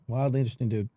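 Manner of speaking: a quiet aside repeat; quiet echo. Shown as a demonstration. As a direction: say it again under your breath, savouring it.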